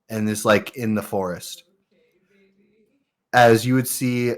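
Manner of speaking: ordinary delivery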